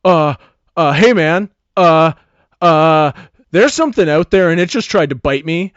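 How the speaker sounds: scared jock blurting out his fright; frightened. Acted. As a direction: burst in terrified and stammering. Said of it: "comes barging into the room in a fright".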